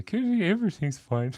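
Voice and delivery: high-pitched, hammed-up Canadian accent; mock Canadian accent. Shown as a demonstration. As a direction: put on a silly Canadian accent, laughing. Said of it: "That's my Canadian accent".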